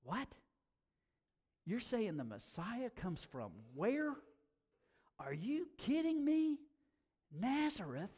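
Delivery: incredulous, rising in pitch on the doubt; mock disbelief, jumping up high on are you kidding me; skeptical disbelief. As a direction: play the scoffing skeptic who can't believe it. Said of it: "was a skeptic".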